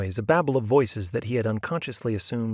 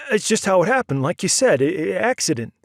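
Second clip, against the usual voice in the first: high, loud, stammering plea; frightened plea; 8 semitones higher, 7 dB louder than usual. A narrator's reading. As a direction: beg them to believe you. Frightened Oscar pleading.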